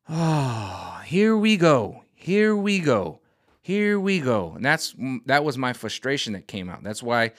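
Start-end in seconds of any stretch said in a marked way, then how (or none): 0.1-4.5 exasperated. Real, not acted. Exasperated sigh.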